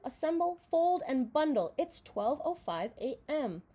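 ordinary delivery

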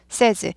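"says" with the vowel changed to a short "e"; says pronounced SEZ. Shown as a demonstration. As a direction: model "says" as "sez", without the "ay" glide. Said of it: "A에서 에 발음으로 바뀝니다". (says의 모음을 "에"로 발음)

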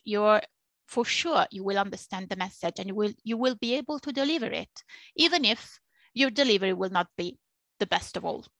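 usual speaking voice

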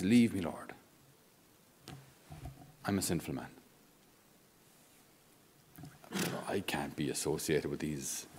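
low, soft, broken confession; humbled, kneeling plea. Acted. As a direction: plead quietly, unworthy before the Lord. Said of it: "Peter drops to his knees and says".

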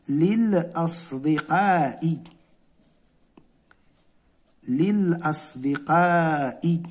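للأصدقاء drawn out, li-l-as-di-qaa-i; slow model word. Shown as a demonstration. Teacher modelling the word for pupils, slow. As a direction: say للأصدقاء slowly, syllable by syllable. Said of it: "شنو غنقولوا؟".

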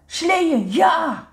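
high, loud burst of delight; excited shout. A narrator's reading. Woezel's eyes shoot open at the idea of sledding, excited shout. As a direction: cheer it out, suddenly wide awake. Suddenly wide awake for sledding.

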